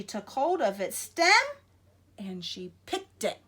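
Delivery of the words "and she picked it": plain reading voice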